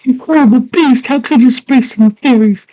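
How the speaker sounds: high, mock-indignant girl; scolding girl voice. Girl scolding the fairy sprayer, high mock outrage. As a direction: scold in a put-on high girl's voice. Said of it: "And she's like".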